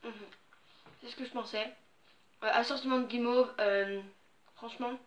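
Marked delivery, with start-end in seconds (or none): 0.0-1.7 muffled by soft candy; chewing while talking. Real, not acted. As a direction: mouth partly full. Seen 0: Bit candy at -7, jaw working, candy in hand throughout.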